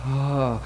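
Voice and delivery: low, resigned "oh"; heart-sinking sigh, acted; weary sigh. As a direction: let out a heavy sigh of dismay. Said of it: "we usually do the".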